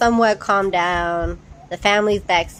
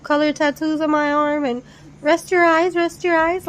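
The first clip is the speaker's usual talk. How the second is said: mocking the sister; 8 semitones higher than usual. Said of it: "the sister go off talking".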